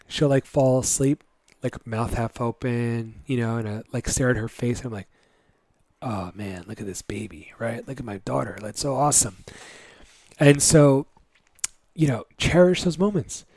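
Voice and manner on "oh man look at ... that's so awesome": lower, much softer murmur; dad gazing at his sleeping daughter, hushed; tender awe. Retold or imitated. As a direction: marvel quietly over the sleeping baby.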